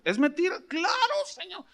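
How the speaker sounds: high, laughing back-and-forth; half laughing as you answer; high laughing reply; laughing exchange. Retold or imitated. Retold dialogue with God, laughing.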